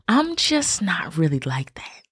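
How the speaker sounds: a quick confiding whisper; whispered aside. Real, not acted. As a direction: drop to a breathy secret. Confessing she dislikes touch.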